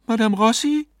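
high, questioning woman's voice; wife's anxious query. A narrator's reading. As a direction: ask for the medium, high and rising. Wife questions the stunned medium.

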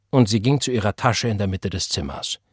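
ordinary delivery, nothing marked

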